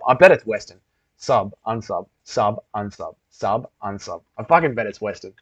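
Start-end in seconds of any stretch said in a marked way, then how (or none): 1.2-4.2 low, evenly repeated mock chant; mock repetitive toggling. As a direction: imitate someone idly flicking back and forth. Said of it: "Someone is just sitting there being like".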